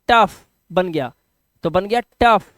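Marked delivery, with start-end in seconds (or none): 0.1-0.5 टफ said as one joined syllable; blended together. Shown as a demonstration. Teacher has learners join the sounds, blended together. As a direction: run ट and अफ together into टफ. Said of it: "अब एक साथ बोलो".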